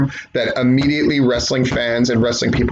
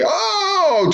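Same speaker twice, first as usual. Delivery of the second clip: "Oh!" mocked in a high squeak; a lightly hammed-up "Oh!" to mock them; mock commentator oh. Mocking commentators who say "Oh!" twenty times, high squeak. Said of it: "if I have to listen to them say".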